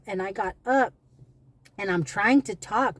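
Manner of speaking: ordinary delivery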